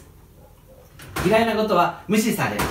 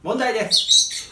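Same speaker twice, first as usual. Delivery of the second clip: ordinary talk, nothing marked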